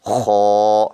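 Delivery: خا full and heavy from the back of the throat; heavy kha. Shown as a demonstration. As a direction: fill the mouth with خا. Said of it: "اس کو پر کر کے پڑھیں گے".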